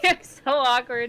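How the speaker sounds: words through giggles; awkward laugh through the words; laughing while talking. Real, not acted. Giggling over saying names.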